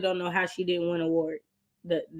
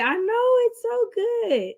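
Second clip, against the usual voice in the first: gushing; 12 semitones higher, slower than usual. Real, not acted. Gushing to chat comment.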